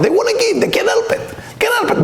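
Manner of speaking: rising up in delight at their generosity; excited high burst. Real, not acted. Preacher to listeners, soaring high with excitement. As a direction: voice leaps way up with excitement.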